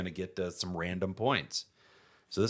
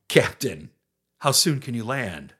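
acted doctor line; 3 semitones higher, 7 dB louder, slower than usual. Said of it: "some brogue".

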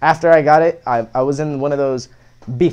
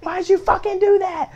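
much higher, mock-furious mom yelling. Puerto Rican mom scolds her son over the face tattoo, high mock fury. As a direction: scold like a raging mother. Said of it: "she's freaking out".

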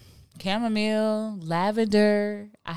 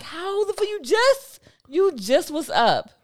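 high, slow incredulous question; mock disbelief; 9 semitones higher, slower than usual. Telling how her partner falls asleep instantly, high disbelief.

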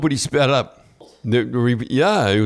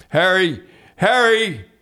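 calling out high and loud, name over and over; hollering into the phone into dead silence; 9 semitones higher, 3 dB louder than usual. Retold or imitated. Coach hollering down the phone for the GM, high and loud. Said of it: "and I kept hollering".

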